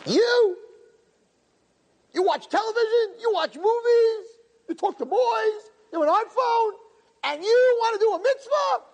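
very high, jeering accuser's voice that keeps shouting; mocking satan. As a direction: taunt the sinner like a sneering demon. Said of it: "the satan comes to you and says".

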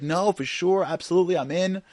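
keen agreement thrown into a work call; a touch of busy-dad salesmanship; multitasking dad. Acted. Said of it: "the whole time, like".

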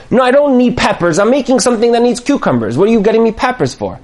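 high, quick acted scolding; exasperated wife. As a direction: tell off your husband at a fast clip. Wife scolds husband over wrong vegetable.

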